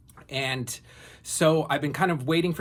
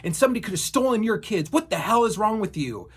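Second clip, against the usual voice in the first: imitating angry man; 8 semitones higher than usual. Man in truck scolding her about kids, mock angry yell. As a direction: berate her like a furious stranger. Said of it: "He's like".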